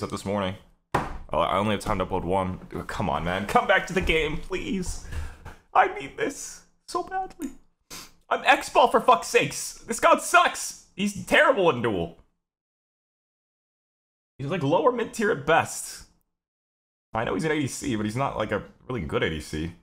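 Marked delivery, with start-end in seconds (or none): 3.5-7.5 high pleading whine, voice cracking up. Real, not acted. Begging opponent to return to game. 8.3-16.0 high, loud exasperated complaint; frustrated high rant. Real, not acted. Frustrated rant at his god.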